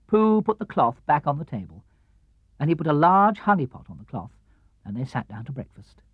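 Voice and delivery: ordinary delivery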